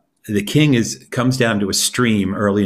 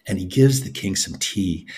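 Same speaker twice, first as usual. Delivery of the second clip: ordinary delivery, nothing marked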